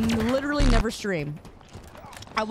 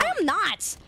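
mock indignation; 7 semitones higher than usual. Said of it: "when I'm getting angry at you".

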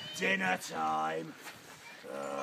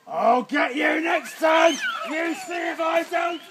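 loud, higher troll threat; troll shout; 10 semitones higher, 9 dB louder than usual. Acted. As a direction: yell it after the fleeing goat. Troll shouts after fleeing goat.

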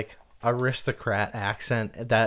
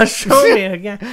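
laughing; 7 semitones higher, 7 dB louder than usual. Retold or imitated. Imitating the accent, laughing.

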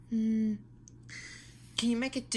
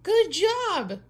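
excited praise; 8 semitones higher, 10 dB louder than usual. Acted. Praising kid's kite trick.

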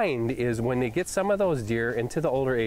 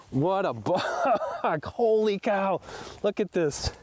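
can't believe the size of him; elated laughing exclamations; real excitement; 7 semitones higher than usual. Real, not acted. Walking up on the buck.

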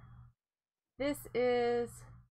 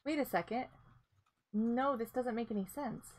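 ordinary talk

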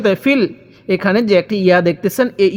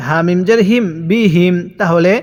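normal talking voice

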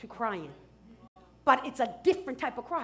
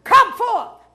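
high, commanding shout; huge, high-pitched summons; crying with a loud voice; 8 semitones higher, 10 dB louder, slower than usual. Acted. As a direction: call the dead out at full voice. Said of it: "He cried with a loud voice".

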